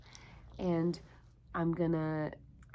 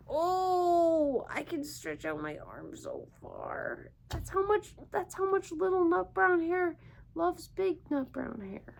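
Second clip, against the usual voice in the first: excited stretching; 8 semitones higher than usual. Acted. Teacher stretching with children.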